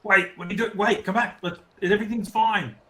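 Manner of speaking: high-pitched call to come back; acted yell. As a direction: yell after someone to reassure them. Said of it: "I'll just yell".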